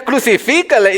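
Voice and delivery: high-pitched mock cry; half-shouted imitation of a crowd; imagined crowd shout. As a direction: call it out as one of the mob. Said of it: "Tú estarías ahí gritando también".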